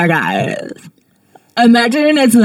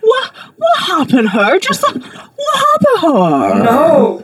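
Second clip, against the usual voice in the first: shrieking; 6 semitones higher, 3 dB louder than usual. Acted. Mock outrage over a drawing.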